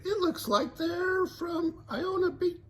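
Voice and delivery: high, slow Velma. Acted. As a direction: clue reasoning. Velma voiced.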